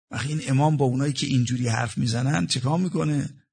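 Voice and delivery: normal talking voice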